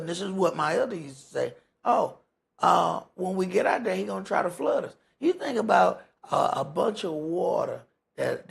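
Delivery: normal talking voice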